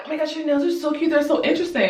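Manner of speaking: high, gushing backhanded compliment. Retold or imitated. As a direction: coo it high and quick, mock-admiring. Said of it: "So she was like".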